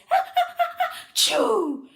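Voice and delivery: high, loud stuttering build into a sneeze. A narrator's reading. Cheerleaders sneezing mid-chant, high and loud. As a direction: let the sneeze build and burst. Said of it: "repeated the fans".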